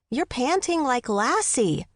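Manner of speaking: put-on slow, high boyish jeer; teasing boy. A narrator's reading. Fitz to his panting mother, teasing.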